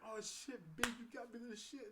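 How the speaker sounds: put-on playful take on her New York accent; New York imitation. Date thanking him for flowers, in a put-on New York accent. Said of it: "imitate a New York voice".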